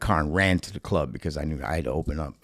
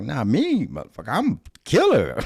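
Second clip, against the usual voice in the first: cocky brag; 9 semitones higher than usual. Retold or imitated. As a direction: brag like a cocky young comic. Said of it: "And I was like".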